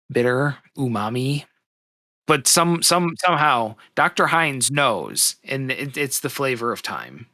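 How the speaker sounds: ordinary talk, nothing marked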